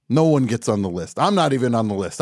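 ordinary talk

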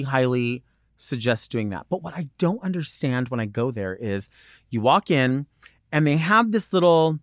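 ordinary talk, nothing marked

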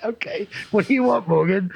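hammed-up official, high and impatient; mock union clerk. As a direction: squeak it out like a fed-up office clerk.

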